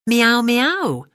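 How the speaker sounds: high, loud meows falling away; plaintive cat meow; cat's cry. Acted. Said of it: "cried Simpkin".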